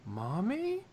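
put-on ghostly child asking Mommy; lightly haunting little voice; spectral voice. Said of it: "in a spectral voice says".

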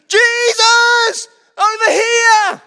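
very loud, high, slow yell; shouting. Retold or imitated. Beggar yelling to Jesus over the crowd, full shout. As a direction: wave him over with a full-throated cry. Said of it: "He shouted all the more".

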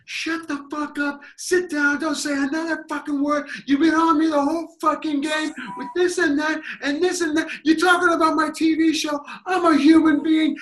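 high, loud furious rant; mock his outburst at full tilt; angry player. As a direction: player ranting at the heckler, hammed-up anger. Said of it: "he was just like".

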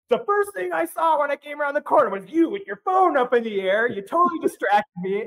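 high, loud, hammed-up furious dad; mock angry dad. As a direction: play the dad snapping at the camera. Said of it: "So mad".

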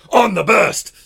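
huge high-pitched yell; segment catchphrase shout. Acted. Host launching Blake's rant segment, huge shout. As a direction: bellow it like a battle cry. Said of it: "it's made you go".

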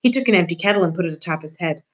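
usual speaking voice

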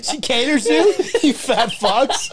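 high, laughing jab drawn out at the end; laughing tease. Real, not acted. Teasing friends about the wife at McDonald's, laughing. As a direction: rib them while cracking up.

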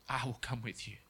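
quietly voiced repeat of the promise; soft repeat. God repeating the promise to the fearful man, softly voiced.

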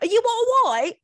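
high put-on voice, bewildered question; mocking impression of a baffled bedmate; imitating confused man. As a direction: mimic his baffled concern way up high. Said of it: "He kept going like that".

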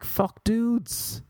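high-pitched indignant outburst; mock outburst. Bitten man cursing all dogs, mock indignant. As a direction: pitch leaps up in mock protest.

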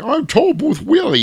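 high, loud put-on character voice. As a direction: introduce yourself in a high cartoon voice.

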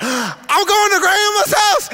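high, drawn-out gleeful squeal; a little kid bursting with delight; excited child. Retold or imitated. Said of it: "I was so excited".

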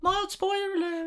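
high-pitched jokey echo; high squeaky voice. Acted. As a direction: squeak it out playfully. Squeaky echo of spoiler alert.

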